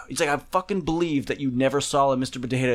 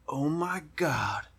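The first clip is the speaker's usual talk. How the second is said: slow, softer breathless whisper; awed whisper; 8 dB quieter than usual. Retold or imitated. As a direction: breathe it out in quiet wonder. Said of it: "it wasn't like I just, like, jumped".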